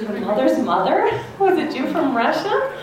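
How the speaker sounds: amazed, laughing disbelief; surprised question. Retold or imitated. Teacher asking her babysitter in amused surprise. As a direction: she can't believe what she just heard.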